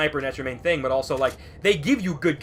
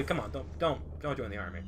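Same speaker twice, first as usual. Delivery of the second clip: softly pleading mock ad; 3 semitones lower, 11 dB quieter than usual. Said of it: "commercials that are basically like".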